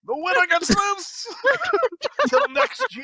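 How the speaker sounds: high, drawn-out wrestling proclamation; mock announcer. Dad announces wrestling prize.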